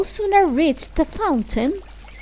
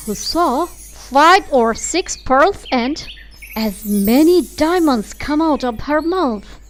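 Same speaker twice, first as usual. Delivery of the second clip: usual speaking voice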